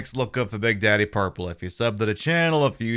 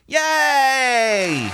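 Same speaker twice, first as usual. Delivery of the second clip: high squeaky yay; mock cheer; 12 semitones higher, 4 dB louder than usual. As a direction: fake delighted squeal. Sarcastic cheer at shower news.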